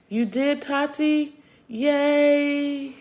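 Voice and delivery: high, delighted yay; excited cheer. Real, not acted. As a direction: cheer them on happily. Cheering a viewer in chat.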